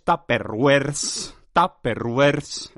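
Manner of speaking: slow relish. Acted. Lingering on the odd word.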